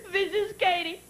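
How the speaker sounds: high, drawn-out tearful whine; hammed-up bawling kid on the phone; crying child. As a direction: imitate a sobbing little niece pleading. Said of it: "twenty minutes later crying".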